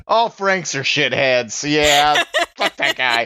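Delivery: high, pinched, jokey voice, well above his own; high silly voice bad-mouthing Frank; squeaky comic character voice. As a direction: play a petty little guy talking trash about Frank. Same character keeps trash-talking Frank.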